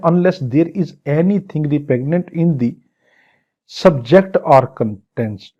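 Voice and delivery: reads each English word of the law very slowly; slow statute reading. Shown as a demonstration. Teacher reads the law's English clause very slowly to the class. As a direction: spell out the clause word by word for students.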